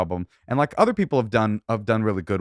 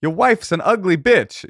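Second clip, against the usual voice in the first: slow, higher, louder taunting heckler; mock insult; 9 semitones higher, 6 dB louder, slower than usual. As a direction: sneer it out slowly.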